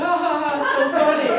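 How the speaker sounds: loud, high laughing gush; a mom cracking up at her kid's word, laughing loudly. Retold or imitated.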